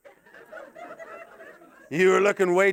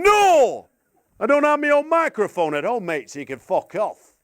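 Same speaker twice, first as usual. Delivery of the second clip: high, loud mock-indignant yell; retold shouted refusal; 5 semitones higher, 6 dB louder than usual. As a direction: shout the refusal, hammed-up Northern English. Retells his shouted refusal.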